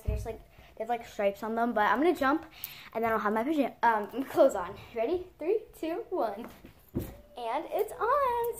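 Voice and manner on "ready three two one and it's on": excited countdown. Real, not acted. Countdown to transition jump.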